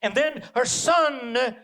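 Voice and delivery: ordinary delivery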